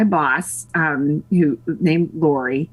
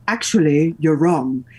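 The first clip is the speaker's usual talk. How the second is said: imitated British husband correcting; British accent; 3 semitones lower than usual. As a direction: put on a British accent to contradict. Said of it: "a husband who has this great accent".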